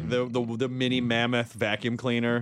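normal talking voice

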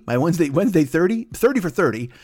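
ordinary talk

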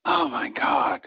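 breathy hushed "oh my God" in awe; whispered gasp. Retold or imitated. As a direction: whisper it in disbelief. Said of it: "I just went".

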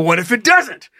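rising panicked what-ifs, pitched high; high, breathless mock panic; retold panic. Panicked inner monologue.

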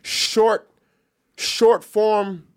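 "short" drawn out slowly and set apart; slow, emphatic "short"; stress on short. Real, not acted. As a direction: lean hard on the word "short", then repeat it. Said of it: "Emphasis on the short".

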